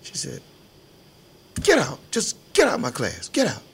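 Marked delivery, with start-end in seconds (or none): 1.6-3.6 high, loud order to get out of class; angry teacher. Retold or imitated. Retelling how the teacher ordered him out of class, loud and high. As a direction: bark the teacher throwing a pupil out.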